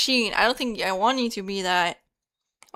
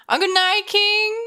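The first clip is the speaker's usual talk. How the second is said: mock high voice; 11 semitones higher, 7 dB louder than usual. High mock greeting to chatter.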